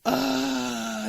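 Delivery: normal talking voice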